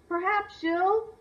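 usual speaking voice

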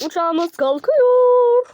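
loud, high, drawn-out call; calling out takeoff. Pretend. Traveler announcing that the plane is taking off, drawn-out loud call. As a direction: stretch it out high like a big announcement.

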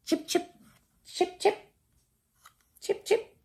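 high squeaky chip chip; high chattering squirrel calls; squirrel chirp. Retold or imitated. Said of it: "what does a squirrel say".

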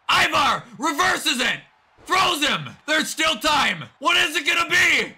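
slow, shouted commentary at a tense moment; urgent play-by-play. Pretend. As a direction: build the suspense like a ringside announcer. Live play-by-play of wrestling match.

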